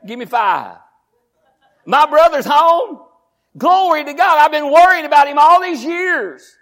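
joyful brother. Acted. Preacher voicing joyful brother.

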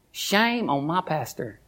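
slow, low mock scolding; mock shame. Pastor teasing himself before the church, mock scolding. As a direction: tut at yourself in jest.

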